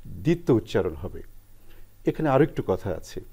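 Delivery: ordinary talk, nothing marked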